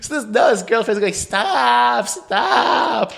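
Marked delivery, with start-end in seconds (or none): 1.1-3.1 high, drawn-out plea; pleading girlfriend. Acted. Girlfriend trying to halt a fight, high plea. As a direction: imitate a girlfriend begging, high and stretched.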